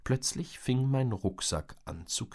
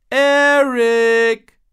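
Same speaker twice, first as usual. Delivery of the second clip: loud high call from afar; shouting; 15 dB louder than usual. A narrator's reading. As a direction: Mom yelling from the house. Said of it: "schrie Mom". (lauter Ruf aus dem Haus)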